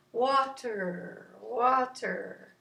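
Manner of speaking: 'water' with a long, flowing ending; floaty. Shown as a demonstration. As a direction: let 'water' float out slowly. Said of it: "it even sounds kind of floaty".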